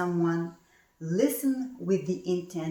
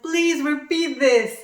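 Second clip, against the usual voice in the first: higher, louder and slow insistence; emphatic retort; 9 semitones higher, 8 dB louder than usual. Retold or imitated. Answering her friend's remark, a loud slow insistence. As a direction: demand they say it again, loud and firm. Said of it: "And I said".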